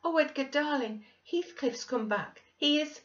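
high, loud, overjoyed exclaiming; breathless excitement. A narrator's reading. Wife bringing husband news of a returned friend, wildly excited. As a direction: gush the great news at a high pitch. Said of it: "breathless and wild, too excited to show gladness".

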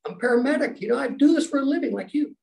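high-pitched, protesting self-quote; mock insistence. Paramedic patient convincing the doctor, mock insistence. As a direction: climb in pitch while pleading your credentials.

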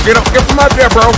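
high, loud urgent yell. Real, not acted. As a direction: shout it at your teammate. Yelling at teammate to move.

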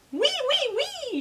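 very high squealed French cheer; shouting alpacas. A narrator's reading. Alpacas in French restaurants, high excited cry. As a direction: chirp it way up high as a crowd of alpacas. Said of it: "shouted the alpacas".

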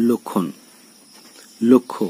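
লক্ষণ, লক্ষ্য as "lok-khon, lok-kho"; doubled k-kh sound. Shown as a demonstration. Teacher gives word examples, লক্ষণ, লক্ষ্য with k-kh. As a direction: hold a k before the kh in লক্ষণ, লক্ষ্য. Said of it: "ক ক্ষয়ের মতো".

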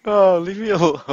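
loud, high wail breaking into laughter; exasperated high holler; laughing protest. Real, not acted. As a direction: cry out, about to crack up. Protest between laughing fits.

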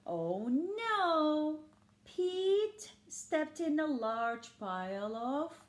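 lively picture-book storytelling; reading to children. A narrator's reading. Reading Pete the Cat to listening children, lively storybook voice. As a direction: read it like bedtime story time for kids.

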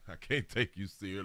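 ordinary talk

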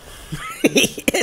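squeaky laugh; sudden high-pitched laugh; high burst of laughter. Real, not acted. Laughing at opponent's play.

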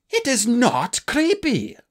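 high, loud, slow outraged cry; indignant cry. Acted. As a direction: cry out, stung. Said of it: "Starflight had cried".

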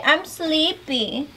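put-on drawn-out, high sleepy baby talk; slow baby talk. Shown as a demonstration. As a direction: stretch it out for the toddler to copy.

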